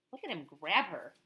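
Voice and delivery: ordinary delivery, nothing marked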